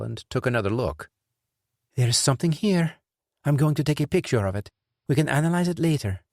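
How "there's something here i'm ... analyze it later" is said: woman's voice. A narrator's reading. Adriana speaks, narrator voices her.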